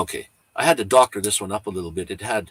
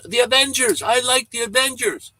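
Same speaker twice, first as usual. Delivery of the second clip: put-on high-pitched, excited little-kid voice; imitating a kid calling out, high and loud; 14 semitones higher, 6 dB louder than usual. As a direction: pipe up loudly like a kid in a store. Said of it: "this little kid really loud".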